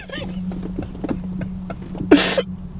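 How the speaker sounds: breathless squeaky laughter; cracking up helplessly; high wheezing laugh. Real, not acted. Teller breaking down at his own boot camp story, wheezing laugh.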